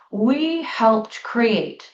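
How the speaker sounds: ordinary delivery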